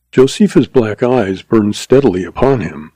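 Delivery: usual speaking voice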